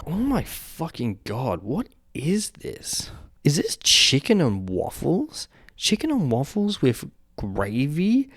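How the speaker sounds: slow, drawn-out, incredulous; appalled slow questioning, pitch up; disgusted disbelief. Real, not acted. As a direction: stretch it out as if you can't believe your eyes. Reacting to a gross dish.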